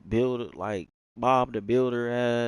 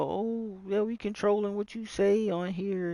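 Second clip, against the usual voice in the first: higher, sing-song mocking voice; mock company voice; 10 semitones higher than usual. Gamer mocking the console company's new rules, high mock voice. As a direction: mimic them in a higher, prissy tone. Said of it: "They go".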